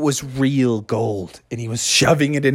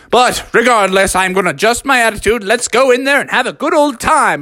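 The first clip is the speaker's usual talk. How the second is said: higher-pitched, resolved pep talk; rally the family, pitched up; rallying father; 9 semitones higher, faster than usual. Acted. Father Frog changing attitude to lead everyone in, higher rallying voice.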